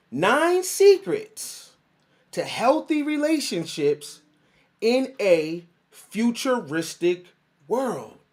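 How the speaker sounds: slow, high, spaced-out announcement; emphatic title announcement. Real, not acted. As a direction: stretch each phrase and pause between. Repeats the show's title for emphasis.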